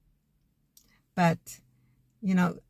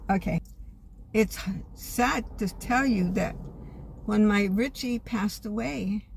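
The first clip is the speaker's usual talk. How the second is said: ordinary delivery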